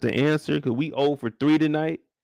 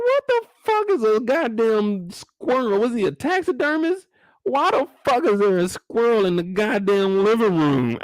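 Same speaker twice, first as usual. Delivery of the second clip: retold disbelief; 7 semitones higher than usual. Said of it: "I said".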